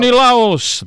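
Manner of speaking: shouted high like casting a spell; spell incantation. Pretend. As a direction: cry it out as a wizard's spell. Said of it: "Harry Potter spells".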